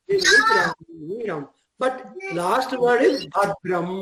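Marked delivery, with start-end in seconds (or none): 0.1-1.4 fierce shout. Shown as a demonstration. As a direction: roar Ugramm like the fearsome lion-god. Dramatizes Narasimha's ferocity.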